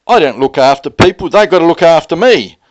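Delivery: wife's loud royal demand, imitated; quoting wife loudly. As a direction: belt it out as the self-important wife. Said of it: "And she turns around and says".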